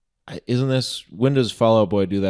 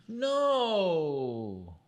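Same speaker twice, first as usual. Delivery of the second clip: gasping no; 8 semitones higher, 8 dB quieter than usual. Real, not acted. Shocked at the [name] news.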